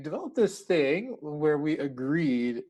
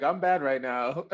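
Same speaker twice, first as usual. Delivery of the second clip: slow, laughing through it; 3 semitones higher, 3 dB louder than usual. Retold or imitated. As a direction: giggle while admitting it. Quoting herself, laughing.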